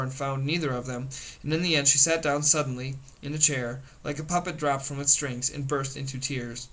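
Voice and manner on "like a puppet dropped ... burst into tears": plain reading voice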